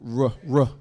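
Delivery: R, R. trilled on the tongue; rolled r demo. Shown as a demonstration. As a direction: trill the R sound alone.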